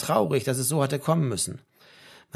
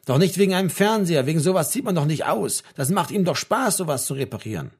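higher, upset protest; tearful protest; 5 semitones higher, 5 dB louder than usual. A narrator's reading. Mother protesting her son moving out, mildly tearful. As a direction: protest in distress, without sobbing. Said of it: "rief seine Mutter und begann zu weinen".

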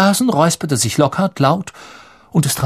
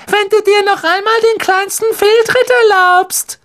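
very high piercing shrill voice; shrill mimicry; 4 dB louder than usual. Malfoy mimics a shrill scolding voice at Ron. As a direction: shriek it like a fussy mother. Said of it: "Mit durchdringend schriller Stimme".

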